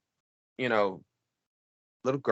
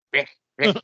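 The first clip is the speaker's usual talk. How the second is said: put-on little girl voice; 3 semitones higher, 5 dB louder than usual. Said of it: "plays the, the little girl".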